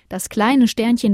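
ordinary delivery

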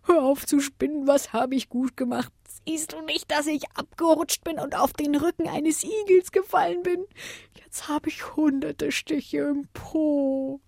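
high, pained complaining; high wailing voice, dragging out at the end; whining little wind. A narrator's reading. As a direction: moan about being hurt. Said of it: "jammerte der Wind".

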